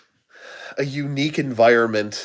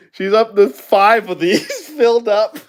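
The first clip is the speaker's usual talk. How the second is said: laughing; 9 semitones higher, 5 dB louder than usual. Real, not acted. Laughing while telling.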